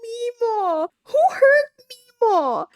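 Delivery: very high, slow, drawn-out whine; mock-distressed wail. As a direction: wail in exaggerated pity, stretching the words. Cooing over a terrified game character.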